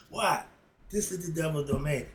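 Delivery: ordinary talk, nothing marked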